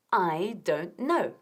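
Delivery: slow, clear model phrase. Shown as a demonstration. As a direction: model it slowly.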